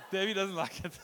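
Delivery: teasing Davey in the crowd, laughing; laughing tease. Real, not acted. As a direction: rib a friend while laughing. Teases a listener.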